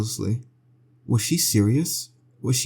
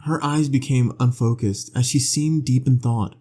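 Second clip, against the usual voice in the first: ordinary delivery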